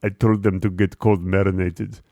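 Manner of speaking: low, put-on Russian waiter voice; fake Russian accent. Waiter bragging to his buddies, fake Russian accent. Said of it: "drop the fake Russian accent".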